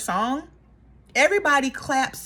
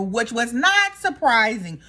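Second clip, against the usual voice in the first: ordinary delivery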